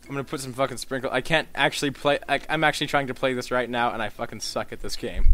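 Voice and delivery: ordinary delivery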